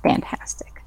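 sarcastic whispered word; whispering. Real, not acted. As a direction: whisper it dryly. Reacting to the dice roll.

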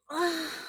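a long tired sigh; weary sigh. Acted. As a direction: sigh out, drowsy. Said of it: "I sighed".

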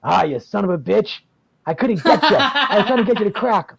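a hint of a gruff, laughing owner; gruff club owner. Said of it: "he goes".

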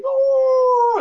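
high loud "oh" yelp; mock scream. Imitating screaming while chewing.

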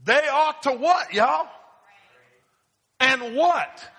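loud, high call for the crowd to answer; calling out to congregation. Real, not acted. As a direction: shout the question out and wait for the answer.

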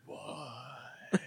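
mock stunned murmur; stunned hushed what. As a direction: gasp it out, gutted.

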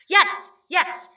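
gasped, high, excited yes; eager confirmation. Acted. Visitor seizing on a known name, excited. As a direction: seize on it with sudden hope. Sudden relief at recognised name.

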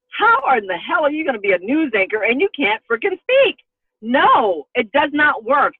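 exasperated and insistent; indignant venting. Retold or imitated. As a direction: protest it like an outraged self-challenge. Venting how losing speech felt.

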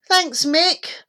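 high, slow, heartfelt thanks; grateful boy. A narrator's reading. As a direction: thank your rescuer with relief. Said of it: "said Norman".

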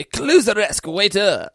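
put-on indignant French waiter accent. French waiter accent, offended. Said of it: "doing the French waiter voice".

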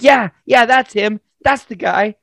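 lightly voiced wife, pitched high; imitated accusing wife. Wife confirms culprit to angry husband, mock accusing. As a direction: mimic a wife eagerly backing up the accusation. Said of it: "they're just giving me a tongue lashing".

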